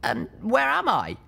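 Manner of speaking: high, wary question; a hurt young mare, uneasy; young mare's voice. Acted.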